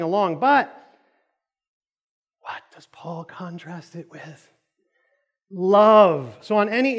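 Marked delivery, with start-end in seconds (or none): 2.4-4.5 slow, suspenseful whisper; hushed question. Real, not acted. Preacher prompting the congregation for the answer, slow whisper. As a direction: drop to a whisper to draw them in.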